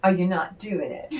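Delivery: normal talking voice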